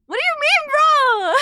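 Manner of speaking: high, loud mocking "bro" voice; a squealed, mocking echo of chat; mock exaggerated accent. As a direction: throw their word back at them, exaggerated. Mocking chat's accent claim.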